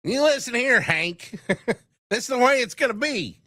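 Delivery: put-on high, barking old-timer; cranky old man impression. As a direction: scold like a grumpy grandpa. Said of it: "not cotton".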